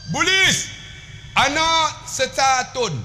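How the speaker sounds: high, slow mock Arabic; broken Arabic. Uncle speaking broken Arabic.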